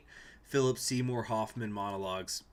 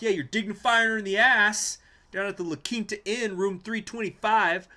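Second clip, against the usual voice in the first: ranting movie agent; 10 semitones higher, 10 dB louder than usual. Retold or imitated. Gus taunting his boss, high loud jab. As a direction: spit out the insult, then drag out the room number. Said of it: "he does that rant".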